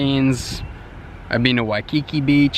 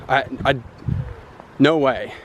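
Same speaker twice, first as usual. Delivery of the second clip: ordinary talk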